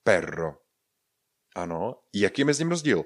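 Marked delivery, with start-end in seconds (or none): 0.0-0.6 lower, falling "Perro" with a trilled RR; rolled double R. Shown as a demonstration. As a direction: model "Perro" with the R rolled long. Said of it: "s dvěma R vyslovujeme perro".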